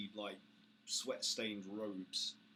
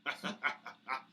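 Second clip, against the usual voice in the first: orc laugh; 4 semitones higher, 5 dB louder than usual. Acted.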